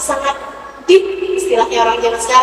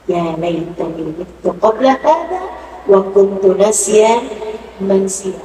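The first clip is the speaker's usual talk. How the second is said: slow, solemn recited verse; Quran recitation; 4 semitones lower, slower than usual. Acted. Preacher to the congregation, reciting the verse slowly. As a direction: draw the Arabic out slowly.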